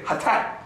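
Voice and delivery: Hatat breathed out on open vowels; native pronunciation. Shown as a demonstration. Teacher showing students his language's word, native pronunciation. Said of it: "We'll say".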